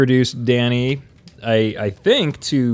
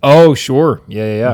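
put-on Canadian accent; 6 dB louder than usual. Host teasing the guest about the episode's accent, mock Canadian. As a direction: agree like a caricature Canadian. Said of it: "work on my Canadian accent".

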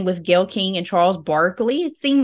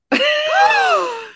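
high-pitched laughing shriek; high squeal; 3 dB louder than usual. Real, not acted. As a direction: let out a high squeal of amusement. Delighted shriek at [name] mention.

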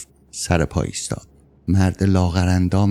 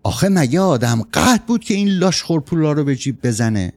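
higher-pitched indignant outburst; narrated angry outburst, pitched up; exploding in anger; 7 semitones higher than usual. A narrator's reading. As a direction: burst out in exasperated protest. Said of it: "بعد ناگهان منفجر شد".

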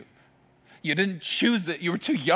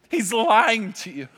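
ordinary talk, nothing marked

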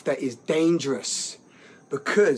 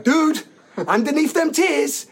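high, loud, drawn-out call; loud, incredulous urging; mock exhortation; 10 semitones higher, 6 dB louder than usual. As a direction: exclaim it slowly, half laughing. Acted exhortation to retold person.

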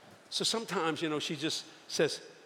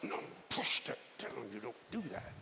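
quiet scolding under the breath; hushed self-scolding; 5 semitones lower, 11 dB quieter than usual. Retold or imitated. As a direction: whisper it to yourself. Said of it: "And I went".

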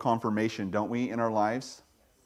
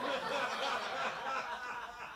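laugh; 12 semitones higher, 11 dB quieter than usual. Real, not acted. Laughs after the joke.

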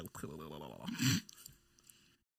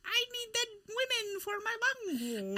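higher, hammy silly voice; mock creepy persona; 9 semitones higher than usual. Friend continues goofy villain bit, hammed-up higher voice.

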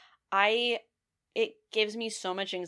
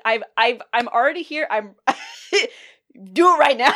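higher, loud desperate urging; loud pleading; 5 semitones higher, 10 dB louder than usual. Retold or imitated. Urging the pharmacist to give the shot now, loud and high. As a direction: beg loudly to get it over with. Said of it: "And I was like".

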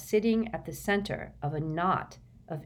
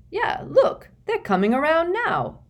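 cheerful; 12 semitones higher, 6 dB louder than usual. A narrator's reading. George cheerfully pointing.

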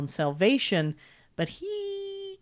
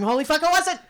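mock panic; 10 semitones higher, 5 dB louder, faster than usual. Imagining waking to find it wasn't a dream, mock panic. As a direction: pitch leaping high in mock horror. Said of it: "can you imagine waking up going".

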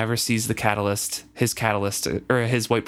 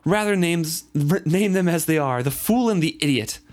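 raised, scolding voice full of disgust; disgusted outburst; 8 semitones higher than usual. Acted. Reader voicing a woman who cries out in disgust at the fool. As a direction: scold him as a careless fool. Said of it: "she cries in disgust".

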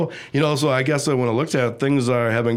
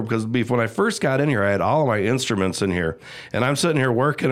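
normal talking voice

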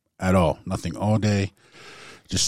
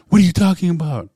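higher, louder bewildered wife; wife woken and scared asking what he means; 12 semitones higher, 10 dB louder, faster than usual. Retold or imitated. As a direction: ask it frightened and confused. Wife scared out of her mind.

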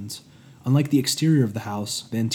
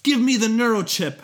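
much higher, louder order; commanding bark; 10 semitones higher, 6 dB louder than usual. Acted. Master demanding the chip back, commanding bark. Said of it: "Hephaestus barked commandingly".

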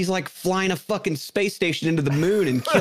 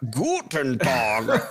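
mock German greeting; 7 semitones lower, much slower than usual.

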